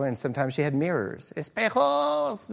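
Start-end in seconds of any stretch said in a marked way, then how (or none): 1.4-2.5 put-on street girl yelling high and squeaky. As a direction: call out like a little girl selling gum. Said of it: "yell in this very high-pitched, squeaky voice".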